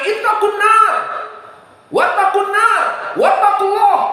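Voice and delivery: the Prophet's fiery shout; shouted warning. Retold or imitated. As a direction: warn them with all your voice. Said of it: "Saking kerasnya".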